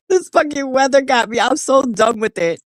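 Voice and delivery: normal talking voice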